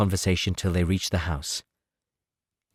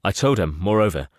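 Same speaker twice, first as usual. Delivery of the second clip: usual speaking voice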